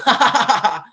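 big burst of laughter, high and loud. Real, not acted. Speaker cracking up at his own story, loud laugh. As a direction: let a loud laugh break out.

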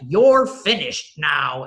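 slow, loud, cold cutoff; stern dismissal. Retold or imitated. Man at the podium cutting off the speaker, slow stern voice. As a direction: shut her down, slowly.

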